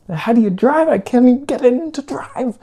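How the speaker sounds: high-pitched helpless. Retold or imitated. Driver telling the guy at the window he can't drive, high mock-helpless. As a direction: whine it way up high in imitation. Acting out own helplessness at stranger.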